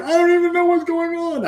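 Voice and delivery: high, loud, cracking panic; panicked. Retold or imitated. Husband retells answering his wife mid panic attack, high strained panicky voice. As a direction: blurt it out in a mock panic. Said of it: "I'm like".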